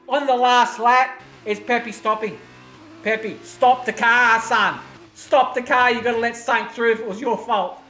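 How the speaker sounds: loud, high, racing commentary at full tilt; rising excitement of live race calling; excited play-by-play. Real, not acted. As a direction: call the last lap like a race caller. Live last-lap race commentary.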